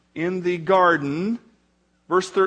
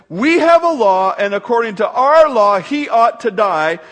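loud, raised declaration of an accuser; forceful insistence; 4 semitones higher, 8 dB louder than usual. A narrator's reading. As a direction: press the charge hard and loud. Accusers answer Pilate in the reading.

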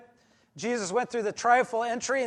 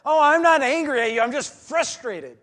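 louder, higher mock protest of not being angry; defensive denial; 4 semitones higher, 7 dB louder than usual. Preacher mimics a congregant denying anger, loud defensive protest. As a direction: play the person insisting they're only frustrated.

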